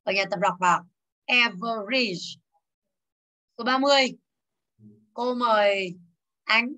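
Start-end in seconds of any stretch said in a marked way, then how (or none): 1.3-2.4 "Average" very slow, each syllable separated; slow pronunciation model. Shown as a demonstration. Teacher urging students to practise "Average", very slow. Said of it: "phải về nhà luyện lại".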